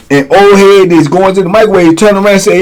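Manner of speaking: ordinary delivery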